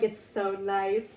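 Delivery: slow, contented sigh of approval; drawn out, savouring the gloom; slow dreamy agreement. Retold or imitated. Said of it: "I'm like".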